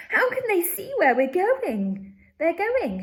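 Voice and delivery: high, loud frightened shriek. Acted. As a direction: shriek it into the dark. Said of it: "shrieked Violet Beauregarde in the darkness".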